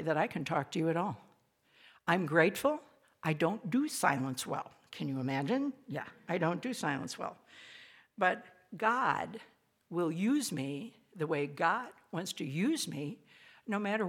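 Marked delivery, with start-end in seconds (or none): none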